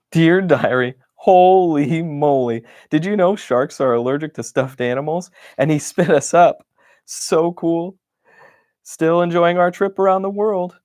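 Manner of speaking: put-on a gushing kid's diary, building up; excited diary voice. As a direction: read it like a thrilled little explorer. Excited diary entry, voiced character.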